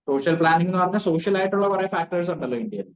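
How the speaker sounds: ordinary delivery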